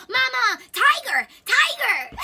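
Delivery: high, loud wailing for mama and a tiger; mock screaming. Tom screaming at a tiger in his trip, hammed-up shriek. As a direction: shriek it, terrified. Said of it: "He's screaming".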